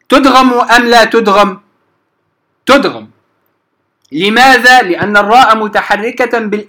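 usual speaking voice

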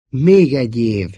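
mildly stubborn insistence; emphatic, obstinate narrated quote. A narrator's reading. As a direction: dig in a little, as if repeating it. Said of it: "hajtogatta magáét konokul".

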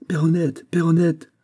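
high, loud call across the garden. A narrator's reading. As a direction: shout the name to reach her. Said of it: "Puis il appela".

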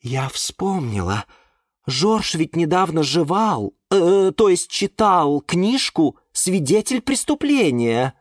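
high, drawn-out feminine musing; orchid character. A narrator's reading. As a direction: remember it aloud with relish. Орхидея вспоминает. (высокий протяжный голос орхидеи)

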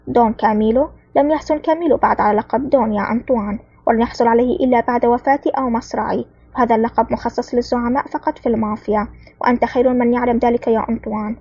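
plain reading voice

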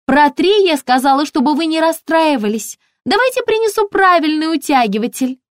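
very high, chirpy shop assistant; high-pitched, ringing sales voice; ringing saleswoman voice. A narrator's reading. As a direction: pipe up brightly through the door. Saleswoman chatters through the door.

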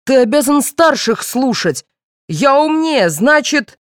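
high-pitched indignant assertion; boiling father. A narrator's reading. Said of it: "вскипел родитель".

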